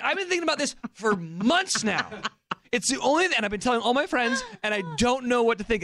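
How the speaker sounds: high-pitched mock agitation; imitating exasperated brother-in-law. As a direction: confess the obsession, comically worked up. Brother-in-law's obsession acted out.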